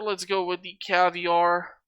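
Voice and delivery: normal talking voice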